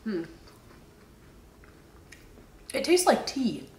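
chewing while talking; talking between bites; mouth partly full. Real, not acted. Seen 0: Left speaker bit cookie at -5, jaw still working early on.

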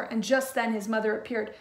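plain reading voice

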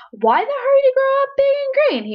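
put-on very high, squeaky young snake asking; high wondering voice. A narrator's reading. Young snake Verdi wondering aloud, very high. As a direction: lift the voice way up, curious little snake. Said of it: "he wondered".

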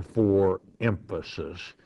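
ordinary delivery

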